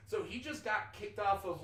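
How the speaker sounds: ordinary talk, nothing marked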